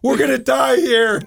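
high, slow, hammed-up cry of doom; mock panic. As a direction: wail it in pretend despair. Co-host mock-panics at the story.

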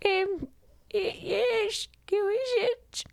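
very high falsetto granny; fake grandmother voice. Wolf posing as grandma at a knock, falsetto. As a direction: pose as grandma in a hesitant high squeak.

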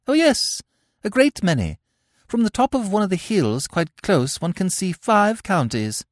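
put-on very high, chirpy country girl; bright hostess. Cecily answering Gwendolen, chirpy and high. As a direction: gush like a proud hostess.

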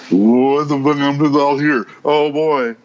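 high goofy imitation of Ovi; mock Ovi. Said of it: "Ovi is like".

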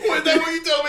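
high, loud, laughing wiseguy disbelief; hammed-up outraged mobster; mock mobster outrage. Arguing with partner, loud mock-mobster outrage.

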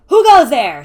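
loud, high challenge; shout. A narrator's reading. Tallest boy challenging arrivals, shouting. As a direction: call out at full volume. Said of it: "shouted the tallest".

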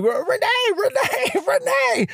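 shrill-high squeak of panic calling a name; high-pitched frantic calling, voice jumping up; high panicked voice. Retold or imitated. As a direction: voice cracking way up in a frantic cry. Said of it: "my voice never had bass".

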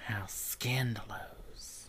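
slow, hushed whisper; mock scandalized. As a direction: whisper it as juicy gossip. Mock shock at church raid.

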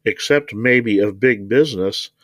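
plain reading voice

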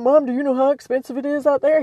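quick, cautious son's voice; worried son. Retold or imitated. Son questioning his mom's move, quick and doubtful. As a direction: lightly voice a skeptical son. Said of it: "He was like".

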